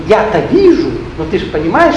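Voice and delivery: ordinary talk, nothing marked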